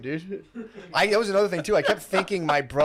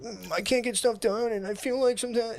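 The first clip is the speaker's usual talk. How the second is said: mock whiny self; 8 semitones higher, 3 dB quieter than usual. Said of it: "Just like".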